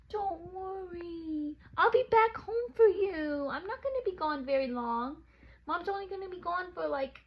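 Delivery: high, soft cooing pet voice. Real, not acted. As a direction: coo to your sad dog. Talking to her dog.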